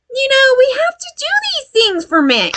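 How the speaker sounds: very high-pitched, sugary mimicry; mock enabling mom. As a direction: parody a submissive mom explaining herself.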